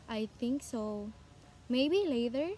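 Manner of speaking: "Maybe later" dipping, then rising unsurely; hesitant tune on "I think so" and "Maybe later"; fall-rise intonation. Shown as a demonstration. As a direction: sound uncertain, "later" lifting at the end. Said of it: "shows confusion or uncertain thoughts".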